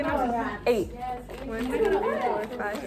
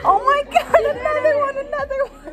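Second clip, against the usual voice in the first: very high-pitched excited outburst; excited squeal; faster than usual. Real, not acted. Reacting after asking "you did it?", excited squeal.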